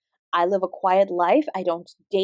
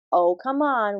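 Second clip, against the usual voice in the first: normal talking voice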